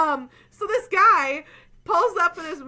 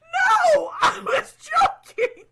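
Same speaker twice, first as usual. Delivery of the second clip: high, loud, slower insistence; shouted protest; 11 semitones higher, 6 dB louder, slower than usual. Retold or imitated. Telling the date she was joking, loud and high. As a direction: shout it, exasperated and laughing. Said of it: "And I'm like".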